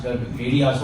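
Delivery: ordinary delivery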